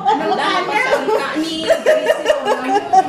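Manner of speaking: high, loud question through laughter; loud laughing question. Real, not acted. (malakas na tanong habang tumatawa)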